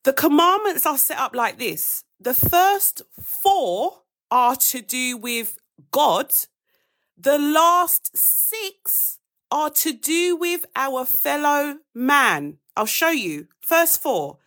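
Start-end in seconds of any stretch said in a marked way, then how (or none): 2.2-12.6 slow, spaced-out teaching delivery; slow and deliberate. Shown as a demonstration. Going back over it slowly for the congregation, carefully spelled out. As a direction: lay each word out slowly so they catch it. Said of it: "I'm gonna go over it again".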